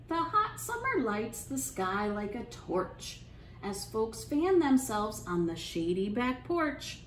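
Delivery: ordinary delivery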